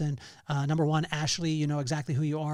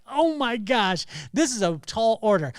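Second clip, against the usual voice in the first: excited disbelief; 6 semitones higher, 7 dB louder than usual. Retold or imitated. Retells own thought at big request.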